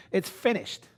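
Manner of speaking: normal talking voice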